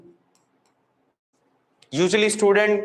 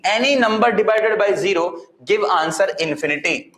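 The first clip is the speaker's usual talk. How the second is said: ordinary delivery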